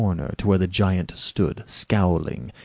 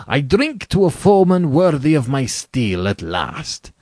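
general's voice lifting into a toast; elated general; 7 semitones higher, 6 dB louder than usual. Acted. General toasting his new opponent at last, elated. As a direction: grow higher and louder with relish.

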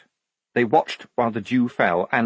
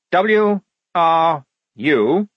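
very slow, raised letter-by-letter reading; spelling out; 8 semitones higher, 6 dB louder, much slower than usual. Acted. Lieutenant decoding the flashes, slowly spelling out. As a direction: call each letter separately, higher.

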